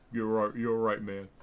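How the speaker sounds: lightly done country accent, hammed-up. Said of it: "that's a terrible accent".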